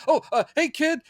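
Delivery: awkward Clark. Retold or imitated. Clark caught out by the girl who thinks he's Superman, high flustered greeting. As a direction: squeak it like you've been found out. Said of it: "He's like".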